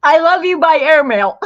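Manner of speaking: very high, loud mock outburst; imitated frustration. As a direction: shout it like an exasperated German teacher. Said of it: "she'd get so frustrated with me".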